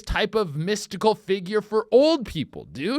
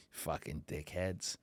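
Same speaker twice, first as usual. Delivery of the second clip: muttered insult; 13 semitones lower, 16 dB quieter than usual. Real, not acted.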